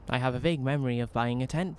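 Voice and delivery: ordinary talk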